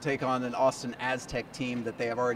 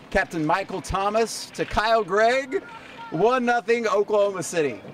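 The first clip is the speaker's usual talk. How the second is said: goal call; 6 semitones higher, 3 dB louder than usual. Acted. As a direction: stretch the names, then punch out the score. Calls the goal in highlights.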